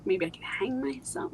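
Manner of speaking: unvoiced hushed whisper. Real, not acted. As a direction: let it slip out as a whisper. Recalling dark thoughts, voice drops.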